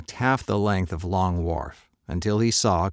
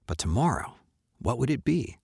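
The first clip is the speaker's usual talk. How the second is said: ordinary delivery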